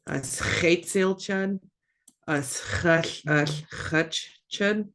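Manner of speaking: usual speaking voice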